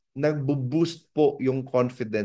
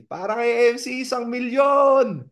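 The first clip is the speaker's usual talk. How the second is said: higher, louder, last words stretched slowly into a shout; street cheer; 8 semitones higher, 4 dB louder, slower than usual. Retold or imitated. Friends outside cheering the speaker with a long shout.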